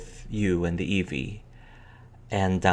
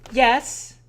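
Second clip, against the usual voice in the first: put-on high, louder child's reply; little sister; 6 dB louder than usual. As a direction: answer as a summoned kid.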